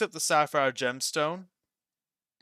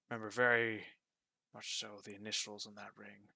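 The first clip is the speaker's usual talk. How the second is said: wistful shopkeeper; 3 semitones lower, 6 dB quieter than usual. Acted. Boss reminisces quietly.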